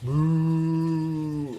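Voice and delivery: long, level imitation moo; imitated cow mooing. Shown as a demonstration. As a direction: moo like a cow, drawn out on one note. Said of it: "What do you think it sounded like".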